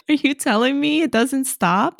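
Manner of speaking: real laughter breaking through the question; laughing disbelief. Real, not acted. Laughing disbelief at afterlife overthinking.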